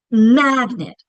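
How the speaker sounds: MAGNET with a long, loud MAG and a light nit; stretched first syllable. Shown as a demonstration. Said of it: "saying that first syllable longer with more emphasis".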